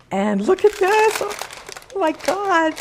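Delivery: high, slow gasp of amazement; amazed. Real, not acted. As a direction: marvel at the finished loaf. Lid off, bread revealed.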